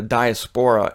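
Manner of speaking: diaspora said wrongly as dia-SPORA, stress on the third syllable; mispronounced. Shown as a demonstration. Host owning up to his mistake, mispronounced diaspora as die-uh-SPORE-uh. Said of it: "and I mispronounced it".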